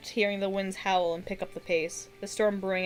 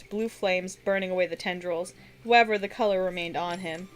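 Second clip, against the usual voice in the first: usual speaking voice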